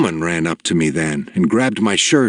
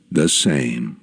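low and slow, pondering the words; a deep-voiced elder turning the words over; soft deep old man, weighing it; 8 semitones lower than usual. A narrator's reading. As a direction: muse on the phrase like an old sage. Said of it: "weighing the words carefully".

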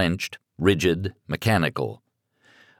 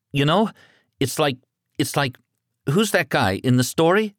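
put-on higher, disbelieving boy voice; young son, stunned and scared; panicked teenage son; 8 semitones higher than usual. A narrator's reading. Son groping for words.

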